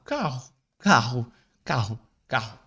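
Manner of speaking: carro with a hoarse throat R; carro, R scraped at the back; guttural R. Shown as a demonstration. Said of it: "Como se fala carro?". (carro com R gutural)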